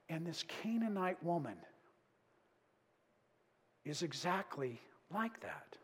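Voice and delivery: softly, intently, letting each line land; hushed preaching. Real, not acted. Preacher hushing the congregation on never giving up. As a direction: drop to a near whisper for the point. Quiet intense sermon climax.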